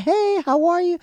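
very high, loud put-on squeal; imitated gushing greeting. Friend's girlfriend greets him at the party, gushing. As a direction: greet in an exaggerated, sky-high voice.

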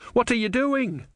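high, startled cry; alarmed Brother. A narrator's reading. Brother seized by sailors, alarmed. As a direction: panic as they seize him.

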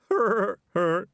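high, loud "hur, hur" chuckle; forced dumb henchman's laugh; thug laugh. A narrator's reading. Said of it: "get a thug laugh like that".

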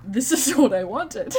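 loud, laughing burst of delight; delighted laughing exclamation. Real, not acted. Fan gushing to co-host about the book, loud and laughing. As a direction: gush it out loudly through a laugh.

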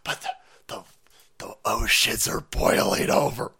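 breathy, unvoiced mock fretting; sarcastic hushed alarm; mock worried whisper. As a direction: whisper it like a frightened believer. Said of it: "you go sitting there going".

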